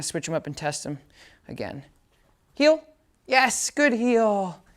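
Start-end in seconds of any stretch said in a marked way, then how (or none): 2.5-4.6 high, drawn-out yes good heel; slow praise. Real, not acted. Trainer marking the heel for the puppy, slow warm praise. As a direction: stretch the praise out slowly. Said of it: "I'm gonna just say".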